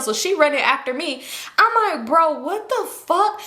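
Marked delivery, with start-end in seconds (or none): 2.1-3.4 high, stretched-out mock panic; panicked disbelief. Aunt losing track of running child, acted panic. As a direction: draw it out in alarm, pitch raised. Cannot find lost child.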